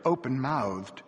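ordinary delivery, nothing marked

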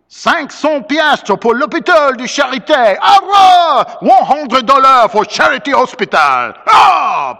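put-on high, loud shouting with French accent; rowdy Creole shouts, bursting into a cheer; mob cry. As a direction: proclaim it to a roaring crowd. Voices the mob's cries.

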